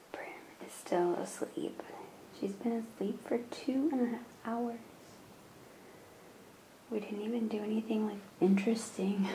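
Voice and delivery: soft, half-whispered talk near a sleeper; hushed. Real, not acted. Mom to camera while Prim is still asleep, hushed. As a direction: keep your voice down, nearly whispering.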